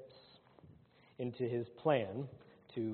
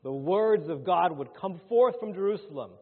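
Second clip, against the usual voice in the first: loud, raised-pitch scripture proclamation; forceful preaching; 6 semitones higher, 10 dB louder than usual. Acted. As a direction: preach the verse out with full force. Preacher declaims scripture at full force.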